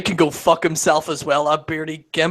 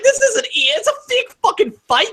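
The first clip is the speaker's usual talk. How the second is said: high, loud exasperated rant; mock exasperated; 14 semitones higher, 3 dB louder than usual. Telling WWE it's a fake fight, high exasperated rant. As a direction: blurt it out in disbelief. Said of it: "I'm like".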